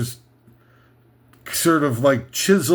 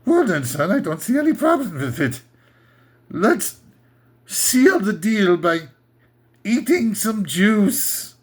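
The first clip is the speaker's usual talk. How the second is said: mock Austrian father; 3 semitones higher than usual. Father replying at table.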